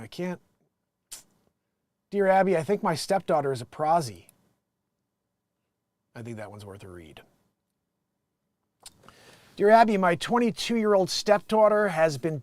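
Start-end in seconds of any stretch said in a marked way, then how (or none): 2.1-4.3 loud, raised read-out voice; letter read aloud. Acted. Host reads a Dear Abby headline, projected. 9.6-12.4 loud, raised read-out voice; letter read aloud. Acted. Host reads a Dear Abby headline, projected.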